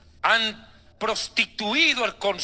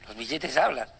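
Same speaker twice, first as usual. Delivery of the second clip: dumbfounded Satan; 8 semitones lower, 6 dB quieter than usual. Pretend. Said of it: "reacción posible de Satanás".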